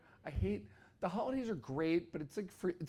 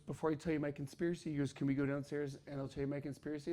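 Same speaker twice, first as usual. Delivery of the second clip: soft and fast, secretive; hurried hush; 3 semitones lower, 6 dB quieter, faster than usual. Retold or imitated. Brother urging to go downstairs, hurried soft hush. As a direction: rush it under your breath.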